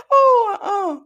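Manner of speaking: high, loud uh-uh of disbelief; loud, shocked refusal; scandalized. Real, not acted. Reaction with cry heard.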